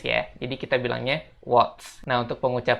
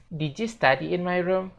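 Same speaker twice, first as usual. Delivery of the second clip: lightly higher, careful model sentence; example sentence; 5 semitones higher than usual. Shown as a demonstration. Teacher reads the example sentence to students. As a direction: read the example clearly for learners. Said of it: "Contoh lainnya kayak gini".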